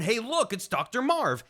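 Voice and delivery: normal talking voice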